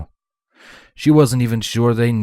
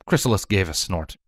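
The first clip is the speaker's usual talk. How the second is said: usual speaking voice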